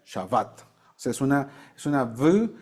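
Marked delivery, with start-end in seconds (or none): 0.0-0.6 Shabbat with a soft dental V, low; V pronunciation. Shown as a demonstration. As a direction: show the V in the middle of Shabbat. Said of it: "¿cómo se pronuncia?".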